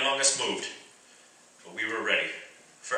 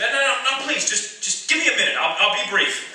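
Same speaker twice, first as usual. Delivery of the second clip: urgent begging; 9 semitones higher than usual. Acted. Begging the men to listen.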